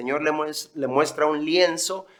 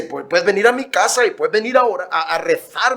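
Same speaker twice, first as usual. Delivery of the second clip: put-on Spanish accent; 4 semitones higher, 6 dB louder than usual. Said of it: "el acento característico español".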